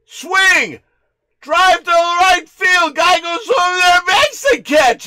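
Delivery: frantic shouted home-run call; excited call of the hit. Pretend. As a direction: call the hit at the top of your lungs. Calling the big hit.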